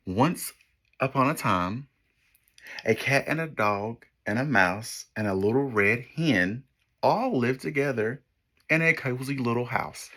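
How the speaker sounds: put-on lively storybook read for kids; story narration. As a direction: read it like a picture book to children. Reads picture book to class.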